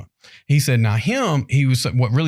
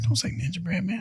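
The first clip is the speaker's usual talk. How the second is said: hushed warning; 7 semitones higher, 9 dB quieter than usual. Retold or imitated. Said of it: "I was like".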